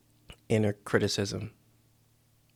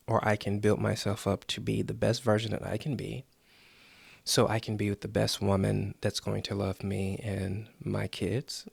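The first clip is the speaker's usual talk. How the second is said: usual speaking voice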